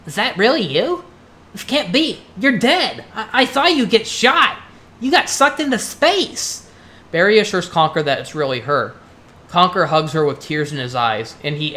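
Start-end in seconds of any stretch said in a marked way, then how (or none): 0.1-6.7 high, incredulous squirrel voice; stunned disbelief. Acted. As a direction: gasp out your disbelief at someone back from the dead. Conker stunned to see Barry alive.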